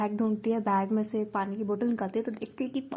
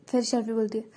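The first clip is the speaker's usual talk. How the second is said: ordinary delivery, nothing marked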